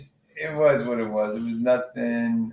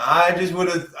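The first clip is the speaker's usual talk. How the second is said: ordinary delivery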